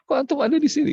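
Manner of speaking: high, loud surprised greeting; mock surprise at meeting the ustaz; surprised call. Mutawif surprised to see the ustaz, calling out high. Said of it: "dia bilang".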